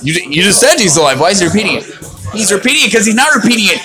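ordinary delivery

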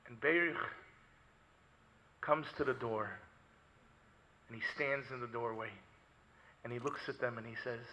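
low, very soft narration; grave, quiet preacher's narration; hushed storytelling. Acted.

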